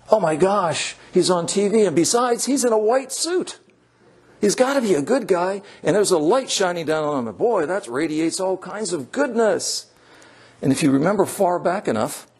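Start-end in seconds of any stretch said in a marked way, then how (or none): 0.1-9.9 gullible viewer. Acted.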